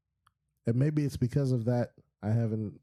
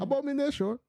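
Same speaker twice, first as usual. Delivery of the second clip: put-on "Abomination" high, in a Nollywood Nigerian accent; dramatic Nigerian accent meme line; African accent. Retelling the Nollywood meme in a Nigerian accent. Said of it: "in her African accent".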